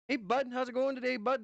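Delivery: hammed-up Upper Midwest North Dakota accent. Shown as a demonstration. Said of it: "That's how we talk up here".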